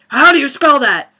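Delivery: high, loud retold question; mock exasperated demand. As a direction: imitate a worked-up referee asking. Retold referee questioning dad.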